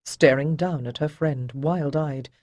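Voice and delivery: ordinary delivery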